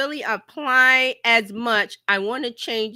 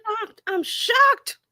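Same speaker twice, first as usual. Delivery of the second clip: mock shock; 12 semitones higher, slower than usual. Sarcastic reaction to the news.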